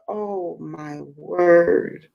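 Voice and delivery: very slow, drawn-out moan of dismay; overwhelmed exclamation. Retold or imitated. Retelling listening to struggling people, a slow stunned dismay. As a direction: let each word sink in slowly. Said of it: "I was just like".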